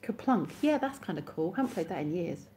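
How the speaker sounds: ordinary delivery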